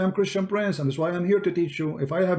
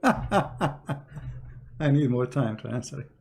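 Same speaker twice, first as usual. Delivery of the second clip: laughing mockery; 3 dB quieter, much slower than usual. Mocking the caller with laughter.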